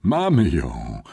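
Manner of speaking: slow, drawn-out delight; delighted panting. A narrator's reading. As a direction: puff it out triumphantly. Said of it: "sapał uradowany".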